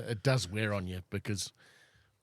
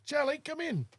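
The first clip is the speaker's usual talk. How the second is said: high, coaxing call to a pet; calling a cat. Real, not acted. Owner calls his cat in from outside, high sing-out call. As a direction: call out to the cat in a much higher voice.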